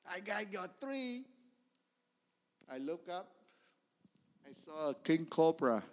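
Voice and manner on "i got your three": snake voice. Retold or imitated. Cobra speaks to him.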